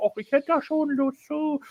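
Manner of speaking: high, sweet coaxing voice; Ruby voice. Retold or imitated. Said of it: "Und Ruby sagt so".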